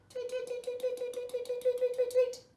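high chirpy tweets for a newborn bird; baby bird chirps. Acted. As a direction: chirp like a tiny chick, light and quick. Baby bird hatches in story.

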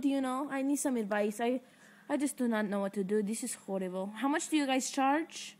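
usual speaking voice

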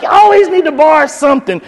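exasperated complaint, pitched high. Retold or imitated. Dad grumbling about the man who keeps borrowing, exasperated. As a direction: complain in a high, put-upon voice.

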